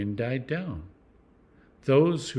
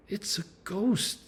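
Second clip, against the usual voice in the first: a touch of fear, pitched higher and slow; mildly frightened disciples spotting a figure; cry of fear; 7 semitones higher, slower than usual. A narrator's reading. Disciples seeing Jesus on the lake, mildly frightened. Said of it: "cried out in fear".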